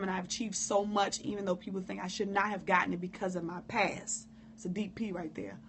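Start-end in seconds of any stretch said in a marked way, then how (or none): 4.5-5.6 whispered aside. Real, not acted. Aside to viewers about her past, whispered. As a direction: drop to a breathless whisper.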